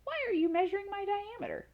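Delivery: a dragon yelping in alarm; dragon crying out. Acted. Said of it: "Circumference cried out".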